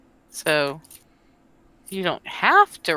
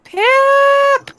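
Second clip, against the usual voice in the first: high, loud cry of the name; dismayed cry; 8 dB louder than usual. Pretend. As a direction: call out in dismay, pitched way up. Cries out for endangered character.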